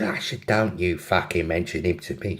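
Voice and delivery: low put-on London accent; mock-indignant London girl, kept low. London girl warning her friend off the subject, London accent. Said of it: "have a go at the accents".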